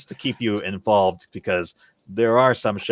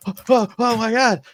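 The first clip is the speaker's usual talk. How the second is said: high, loud, stammering awe; reliving childhood excitement, much higher and louder; awestruck; 14 semitones higher, 7 dB louder than usual. Retold or imitated. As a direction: squeal it like a twelve-year-old fan. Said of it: "I was just like".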